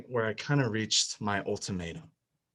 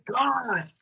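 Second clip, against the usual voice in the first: yelling at God; 13 semitones higher than usual. Retold or imitated. Despairing man yells up at God in retold scene. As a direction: shout the name up in despair. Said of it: "yelled and I said".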